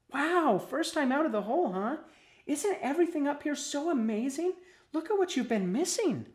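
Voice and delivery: high-pitched, enthusiastic worm friend; excited amazement. A narrator's reading. Worm welcoming newcomer out of the hole, excited. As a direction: marvel brightly in a high voice. Cassie marvels at Willie's news.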